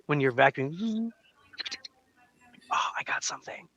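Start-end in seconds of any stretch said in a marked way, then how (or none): 2.7-3.7 whisper. Pretend. Vacuum finding debris, whispered.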